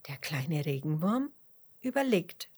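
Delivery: usual speaking voice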